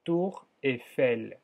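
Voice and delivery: "Tour" lento, u cerrada y erre francesa; Tour francés. Shown as a demonstration. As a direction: pronuncia "Tour Eiffel" despacio y claro. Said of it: "Torre se dice tour". (la profesora modela "Tour Eiffel" despacio)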